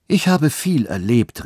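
mild outcry; calling out. A narrator's reading. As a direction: exclaim it with a lifted pitch. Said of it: "rief die Alte".

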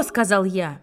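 ordinary delivery

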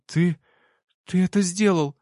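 usual speaking voice

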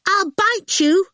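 lightly voiced little girl; bossy little girl. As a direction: pitch it up like a cheeky small girl. Girl Awful voiced in story.

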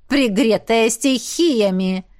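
slow, poisonous sneer; venomous. A narrator's reading. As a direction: drip venom into it. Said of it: "добавила шатенка, не жалея яда". (ядовито, с презрением)

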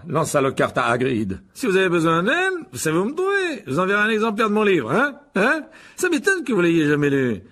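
loud, high, self-satisfied showman with little chuckles; pompous show-off wizard. A narrator's reading. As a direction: crow merrily, pleased with yourself. Voices boastful wizard Lockhart. (vantard jovial, voix haute et forte)

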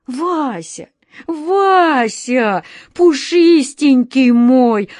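high, drawn-out pleading cry, building loud; desperate shout. A narrator's reading. Wizard begging his furry friend to stay, desperate shout. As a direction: call him back desperately, stretching the vowels. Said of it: "отчаянно закричал волшебник Алёша".

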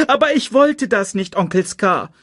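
high, loud plaintive protest; pleading child. A narrator's reading. Simba pleads with Uncle Scar, distressed. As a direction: plead innocence in a young high voice.

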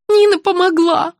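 put-on high childlike voice; confused old woman. A narrator's reading. Patient recalling who helped her, confused old woman's voice. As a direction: say it in a high, upset voice.

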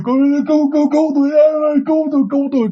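high, strained cry for help. Retold or imitated. Head in the toilet, he cries for help, high and strained. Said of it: "Đó là kêu".

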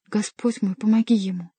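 plain reading voice

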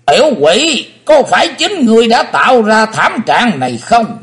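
high, loud eerie demon voice; half-human demon voice. A narrator's reading. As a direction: snarl like a half-human spirit. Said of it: "giọng nói nửa người nửa tinh ma".

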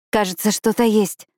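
a touch of eager excitement; excited. Acted. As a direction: say it with hushed thrill at the find. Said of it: "взволнованно сообщаю я".

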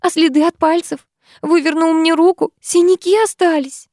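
high, tearful complaint; acted distressed wailing. A narrator's reading. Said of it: "рыдая".

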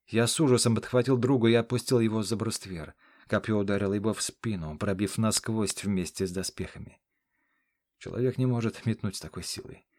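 ordinary delivery

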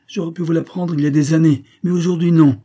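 high, loud, contemptuous outburst; scornful cry. A narrator's reading. Stranger tells the major robbery is not his aim, loud scorn. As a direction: raise the voice in fierce scorn. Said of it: "s'écria-t-il d'un ton de mépris".

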